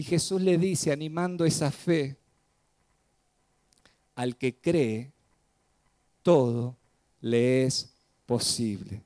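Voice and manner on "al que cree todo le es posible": low encouraging. Acted. Jesus encourages the father's faith.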